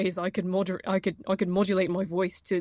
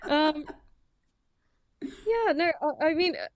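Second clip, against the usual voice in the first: laughing; 9 semitones higher, slower than usual. Real, not acted. Laughing after the host's joke.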